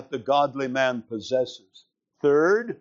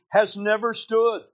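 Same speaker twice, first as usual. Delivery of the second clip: "has never stood" rising higher, stress on "stood"; emphatic never stood; 6 semitones higher than usual. Shown as a demonstration.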